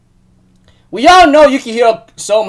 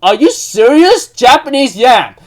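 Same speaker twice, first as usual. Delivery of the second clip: amazed; 5 semitones higher, 5 dB louder than usual. Retold or imitated. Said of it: "And everyone's like".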